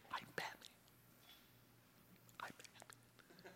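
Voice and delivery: unvoiced gravelly-free hushed Batman line; mock Batman whisper, slowing down. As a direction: hush it like a brooding superhero. Batman voice practiced in mirror.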